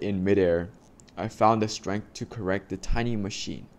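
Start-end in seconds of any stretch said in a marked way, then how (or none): none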